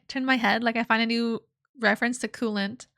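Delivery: ordinary talk, nothing marked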